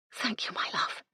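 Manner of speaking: breathy hushed thanks to a portrait; whispered, intimate; tender whisper. A narrator's reading. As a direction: whisper it softly to a lost husband. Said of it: "she whispered".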